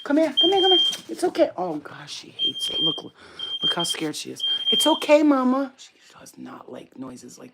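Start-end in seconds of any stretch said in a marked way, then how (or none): 0.0-1.5 high, loud coaxing call. Real, not acted. Calling scared dog. 4.7-5.7 high and louder coaxing. Real, not acted. Soothing scared dog.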